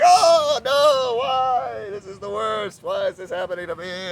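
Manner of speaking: high, loud, whiny wail that fades down; hammed-up panicked wail; mock despair. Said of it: "You're like".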